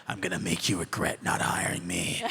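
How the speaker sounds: low, scheming whisper; spiteful whisper. Retold or imitated. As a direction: whisper it like a gleeful threat.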